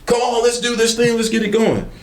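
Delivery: high excited call from a car window; husband calling out. Retold or imitated. Husband hanging out the window calling the salesman, high and eager.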